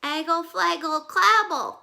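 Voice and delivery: put-on high, loud toddler gibberish; baby babble. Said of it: "Trixie turned to her daddy and said".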